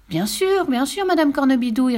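put-on high, too-sweet boy's assurance; mischievous. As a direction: agree with sly innocence. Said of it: "répondit Pierre malicieusement".

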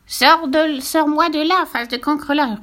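loud, high furious witch; enraged witch bellowing insults; roaring witch. Acted. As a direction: roar the order out. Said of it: "rugit Cornebidouille".

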